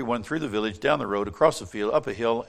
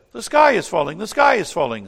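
frantic alarm; 8 semitones higher, 11 dB louder than usual. Acted. Terrified birds warn Chucky Ducky.

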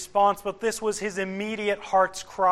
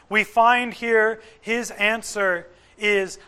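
ordinary talk, nothing marked

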